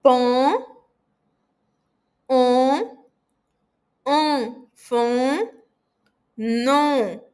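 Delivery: "Pom, on, on, fom, non" bem nasais e destacados; nasal vowels drilled. Shown as a demonstration. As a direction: repita cada som nasal com força. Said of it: "Vamos repetir". (vogais nasais fortes e pausadas)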